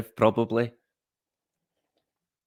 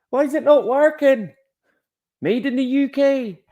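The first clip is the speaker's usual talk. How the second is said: much higher squeaky comic voice; high-pitched mock voice; 14 semitones higher, 4 dB louder than usual. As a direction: pitch it way up like a cartoon. Mock question and punchline voice.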